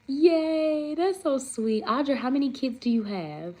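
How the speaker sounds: high, loud little cheer; happy squeal. Real, not acted. Streamer cheering a kind viewer comment, high and loud.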